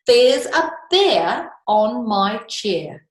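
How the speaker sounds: read-aloud title. Shown as a demonstration. Reading the book title in rhyme.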